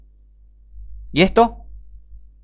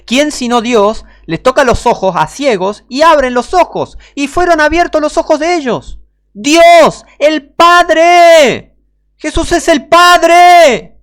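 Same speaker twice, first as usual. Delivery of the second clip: much higher and louder, very slow on the last words; fiery preaching; 11 semitones higher, 9 dB louder, slower than usual. Acted. Preacher proclaiming Jesus is the Father, roaring.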